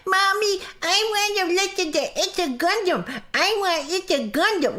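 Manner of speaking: high, childish whining, mocking a baby; high mock baby voice begging; mock whiny baby. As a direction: whine like a toddler wanting a toy.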